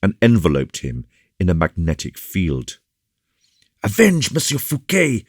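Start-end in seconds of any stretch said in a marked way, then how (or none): 3.8-5.2 high, impassioned rallying cry; excited rebel calling to the crowd. A narrator's reading. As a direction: cry out for vengeance, pitched well up. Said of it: "cried the most excited of his hearers".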